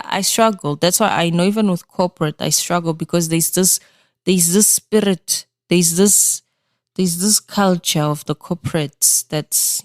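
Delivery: ordinary talk, nothing marked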